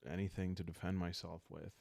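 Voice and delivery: ordinary talk, nothing marked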